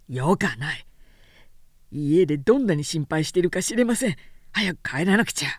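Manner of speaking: a tired craftsman surprised she stayed; gruff craftsman. A narrator's reading. Sōshichi scolding Oyuki for staying late, low and stern. Sōshichi urges her home.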